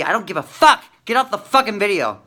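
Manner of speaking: loud, angry outburst at the viewers; aggravated shouting. Real, not acted. Cursing out viewers who think he's crazy, loud and angry. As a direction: snap at the doubters, really fed up. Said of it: "I'm aggravated".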